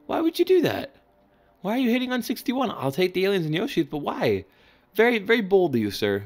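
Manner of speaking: high, squeaky, scandalized disbelief; mock outrage. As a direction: act shocked he'd dare hit on that. Mock outrage at opponent's risky hit.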